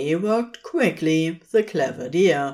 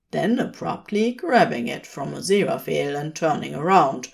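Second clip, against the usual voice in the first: ordinary delivery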